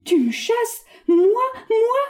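hurt pride flaring up; indignant. Acted. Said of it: "avec une légitime indignation".

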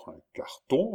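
high, rising question; questioning echo. A narrator's reading. Countess repeating the news, asking in surprise. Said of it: "répéta la comtesse".

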